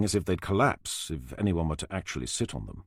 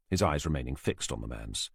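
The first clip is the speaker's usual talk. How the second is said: usual speaking voice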